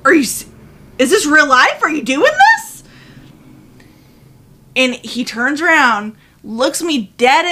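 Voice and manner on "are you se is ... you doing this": high, loud, outraged questions; outraged disbelief. Retold or imitated. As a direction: yell at him in utter disbelief. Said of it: "I was like".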